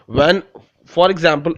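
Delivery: ordinary talk, nothing marked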